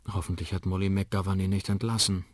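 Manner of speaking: ordinary delivery, nothing marked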